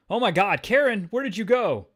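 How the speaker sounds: high, loud frantic cry; panicked Paul. Acted. Paul looking around for Karen, in loud, high panic. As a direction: call out in a hammed-up panic. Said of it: "Paul's looking around".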